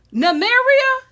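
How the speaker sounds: high, loud, astonished calling of the name; recognising her old wolf in shock; surprised Arya. Retold or imitated. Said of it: "she's just like".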